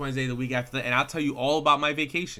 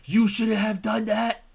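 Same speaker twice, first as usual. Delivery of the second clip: slow, louder and higher mock-heroic outburst; outraged anime hero; 8 semitones higher, 5 dB louder, slower than usual. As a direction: imitate a wronged anime hero, stretching it out. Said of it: "main character is always like".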